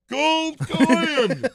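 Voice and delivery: gasp, then a high loud Scottish duck shout; Scottish accent. Retold or imitated. Imitating Scrooge McDuck for friends, loud Scottish accent.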